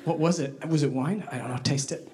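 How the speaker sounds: low, soft, puzzled exchange; hushed confusion. Acted. Diners in the dark puzzling over a spill, hushed confusion. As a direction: keep it down, unsure and puzzled.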